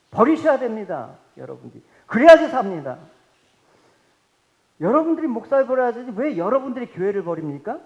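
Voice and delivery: loud, punched exhortation; forceful preaching. Real, not acted. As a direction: hammer it home to the pews.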